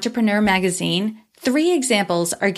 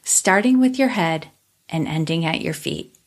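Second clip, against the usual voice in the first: calm meditative tone; 3 semitones lower than usual. Acted. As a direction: ease them into stillness, unhurried. Leads a guided body scan.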